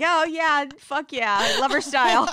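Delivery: high, loud fake enthusiasm; mock gushing fan. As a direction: gush like a fangirl. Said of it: "What am I gonna say? Like".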